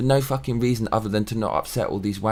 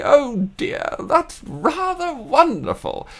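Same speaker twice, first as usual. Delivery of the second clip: much higher, slow, plummy; posh actress impression; slower than usual. Grandson mimicking famous actress grandmother, high and slow. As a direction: imitate a grand old English actress. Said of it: "she used to speak like this".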